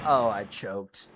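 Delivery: low, slow, deflated admission; dejected sigh. Real, not acted. Driver after blowing the finish, low dejected mutter. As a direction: let it sink in, resigned. Repeats after losing place.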